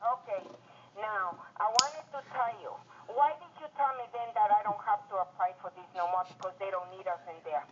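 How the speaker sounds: ordinary delivery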